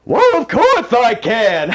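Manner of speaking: dotty giggling tea-party host; Mad Hatter. Acted. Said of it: "Can you do a Mad Hatter voice?".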